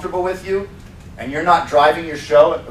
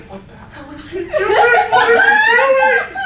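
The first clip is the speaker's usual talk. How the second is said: high, disbelieving cry through laughter; confused trainee; 5 dB louder, slower than usual. Retold or imitated. Trainee reacting to the crowd gathering, high and laughing. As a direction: squeak it like a baffled trainee. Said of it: "the trainee's like".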